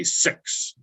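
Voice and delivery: "six" said slowly with a short clipped vowel, New Zealand style; New Zealand accent on "six", vowel nearly "sucks"; Kiwi six. Shown as a demonstration. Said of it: "and in New Zealand".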